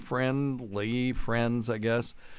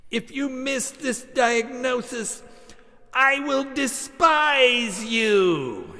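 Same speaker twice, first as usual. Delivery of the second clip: much higher, slow and louder, theatrical warning; eccentric professor yelling; 7 dB louder, slower than usual. Retold or imitated. Professor yelling at his students from afar. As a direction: boom a grand threat at the class. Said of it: "Newton Fisher would yell at us".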